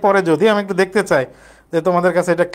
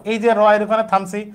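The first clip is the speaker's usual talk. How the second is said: ordinary talk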